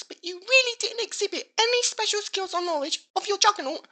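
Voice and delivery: ordinary talk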